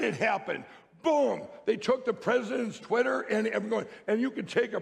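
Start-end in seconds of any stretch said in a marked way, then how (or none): 1.0-1.4 emphatic boom. Real, not acted. Dramatic punchline in testimony.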